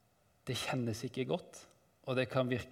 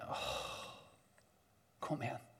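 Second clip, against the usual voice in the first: long sighed "oh", then much softer words; weary sigh; 11 dB quieter, faster than usual. Acted. Seeker who cannot find God, soft exasperated sigh. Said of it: "og man sukker".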